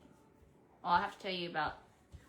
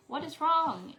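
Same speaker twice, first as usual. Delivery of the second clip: higher, louder alarmed question; alarmed what is wrong; 8 semitones higher, 8 dB louder than usual. Retold or imitated. Mom finding her daughter crying, alarmed question. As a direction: burst out asking what's wrong. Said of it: "and I'm like".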